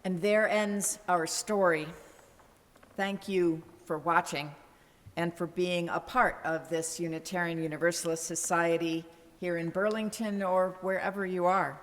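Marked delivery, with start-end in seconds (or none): none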